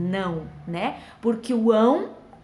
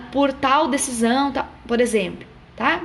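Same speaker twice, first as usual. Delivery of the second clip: normal talking voice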